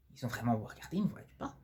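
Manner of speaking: whispered inner thought. Acted. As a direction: say it under your breath.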